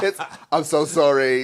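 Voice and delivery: slow, laughing through a mock "I'm so sorry"; laughing impression of a sheepish believer; laughing mock apology. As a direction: apologise while cracking up. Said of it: "I'm like".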